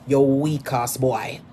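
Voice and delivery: normal talking voice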